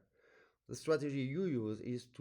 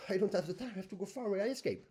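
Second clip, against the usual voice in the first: a touch of higher, hurried inner panic; voice of the body's panic; 6 semitones higher, much faster than usual. Pretend. Teacher voices the body's reflex before a jump, mildly panicked. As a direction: play the body wanting to flee. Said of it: "reflex of your body, which is".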